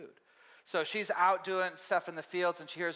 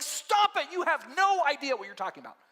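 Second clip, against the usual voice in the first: high, louder, fed-up rebuke; indignant retort; 8 semitones higher, 4 dB louder than usual. Retold or imitated. Teacher retorts to those judging Naomi, indignant. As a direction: shut the critics down. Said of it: "I'm like".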